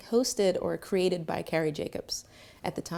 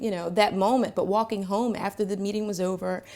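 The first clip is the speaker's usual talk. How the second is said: ordinary talk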